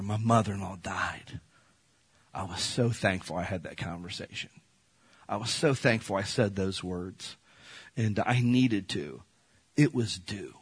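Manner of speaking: quiet, heartfelt remembrance; emotional, hushed. Real, not acted. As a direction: let the loss pull the voice down to a hush. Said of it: "I'm getting emotional now".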